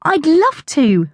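slow, high, smiling answer; grinning. A narrator's reading. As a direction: say it through a big grin. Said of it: "I grinned".

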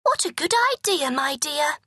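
a gushing queen. A narrator's reading. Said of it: "said the queen".

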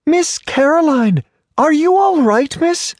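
very high, anxious call; high concerned voice. Acted. A new arrival anxiously calls out to Miss Caroline, very high. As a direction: call out worried for the lady.

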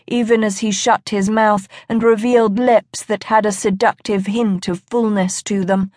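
ordinary delivery, nothing marked

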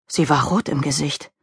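plain reading voice

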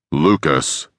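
understated grumble into an ear; mild grumble. A narrator's reading. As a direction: grumble the name lightly, close by. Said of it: "Dean suddenly grumbled into his ear".

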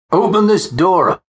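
high, drawn-out shout to open up; commanding constable. A narrator's reading. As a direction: call out a police order, high and slow. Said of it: "It was the voice of Constable Ferguson".

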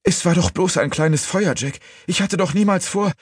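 high, distraught cry; desperate pleading. A narrator's reading. Woman excusing the fire to Jack, high and desperate.

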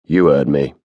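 low, firm constable's retort; authoritative. A narrator's reading. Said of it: "his tone became authoritative".

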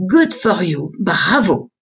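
put-on applauding Frenchwoman's accented English; thick French accent. A narrator's reading. Hostess applauding the visitor's dreams, French accent. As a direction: cheer them on in a French accent. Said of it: "in a thick French accent".

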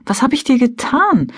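high-pitched, wounded question; hurt, upset. A narrator's reading. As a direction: ask as if suddenly hurt. Hurt wife questions husband's wish for distance. (gekränkt und bestürzt)

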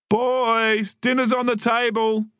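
high, lilting mother's voice; singsong mum call. A narrator's reading. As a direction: sing out to the kids. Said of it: "Mum sang from the patio".

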